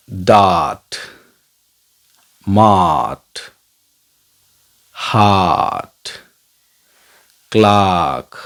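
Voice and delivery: डार्ट, मार्ट, हार्ट with a long open aa, हार्ट stretched slowly; long aa sound. Shown as a demonstration. Teacher drilling the long aa, डार्ट मार्ट हार्ट. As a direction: hold the aa in हार्ट long and slow. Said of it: "दीर्घ आ".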